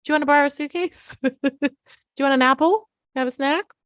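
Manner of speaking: high, sarcastic offer through laughter; mock the kid with fake helpfulness; mocking mom. Mom teasing her runaway kid, high laughing sarcasm. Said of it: "My mom was like".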